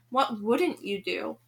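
ordinary delivery

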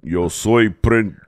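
slow, drawn-out mock Spanish stand-up intro; imitating a comic's slow Spanish intro; mock Spanish stage voice. Mocking a friend's Spanish on stage, slow. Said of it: "you're up there".

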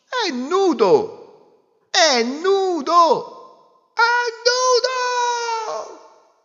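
rising, slow, high shouted chant; crowd shout. Acted. Whole crowd shouting at the emperor, building shout. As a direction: build the yell. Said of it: "gridò alla fine tutto il popolo".

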